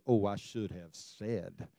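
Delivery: regretful sigh. Retold or imitated. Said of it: "you think of it later".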